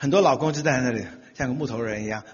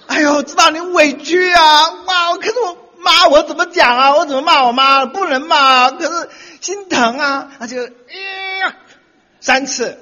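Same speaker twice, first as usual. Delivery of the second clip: high, loud, pleading sympathy; hammed-up consoling husband, much higher; husband consoling wife; 12 semitones higher, 9 dB louder than usual. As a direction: plead tenderly but hammed up.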